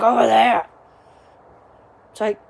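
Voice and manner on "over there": dad calling louder; dad raising his voice to help; raised voice. Retold or imitated. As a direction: repeat the phrase louder, impatient. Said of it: "raising your voice".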